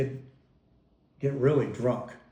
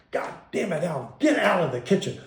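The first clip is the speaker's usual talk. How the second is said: higher, louder mock yell at the husband; angry yell; 5 semitones higher, 3 dB louder than usual. Mom shooing her drunk husband from the kitchen, yelling. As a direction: bark him out of the room. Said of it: "she'd see my dad and she'd go".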